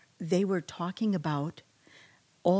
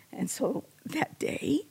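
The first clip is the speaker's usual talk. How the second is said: softer, choked up, higher; real tears rising while testifying; emotional; 8 semitones higher, 4 dB quieter than usual. Real, not acted. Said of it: "I don't know why I'm getting emotional now".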